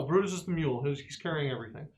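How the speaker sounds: ordinary delivery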